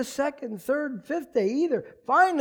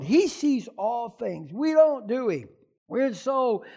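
usual speaking voice

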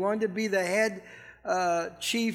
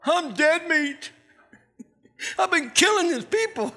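Paul panicking; 7 semitones higher than usual. Retold or imitated. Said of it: "What do you think Paul thought?".

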